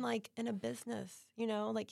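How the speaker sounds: usual speaking voice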